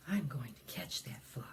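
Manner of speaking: whispered, plotting tiger; hushed tiger scheme to herself; sneaky tiger. Acted. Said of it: "says to herself".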